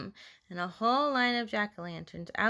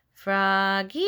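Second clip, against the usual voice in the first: drawn-out call, rising at the end; 2 semitones lower than usual. A narrator's reading. Teacher calling Froggy back, long rising call. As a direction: call the name out, swooping up. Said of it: "called his teacher".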